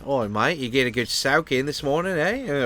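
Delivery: casual mock Aussie accent; jokey Australian accent, lifting at the end. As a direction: put on a playful Australian voice. Jokes as an Aussie.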